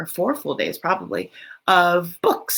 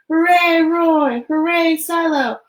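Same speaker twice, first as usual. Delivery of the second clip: high, loud happy cheer; 9 semitones higher, 6 dB louder, slower than usual. A narrator's reading. Zoo visitors cheering the penguin family, high and loud. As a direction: cheer them on with joy. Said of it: "they cheered".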